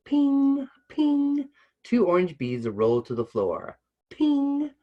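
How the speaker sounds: high pinging bead sounds; ping like beads on a wooden floor; bead ping effect. Acted.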